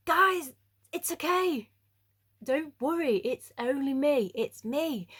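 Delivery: calm the frightened friends; reassuring Jesus. Retold or imitated. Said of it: "immediately goes".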